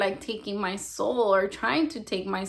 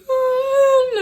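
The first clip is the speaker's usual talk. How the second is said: loud, high-pitched mock wail; imitated crying wail; 10 dB louder than usual. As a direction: imitate a friend crying out in pain. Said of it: "the way that he was crying".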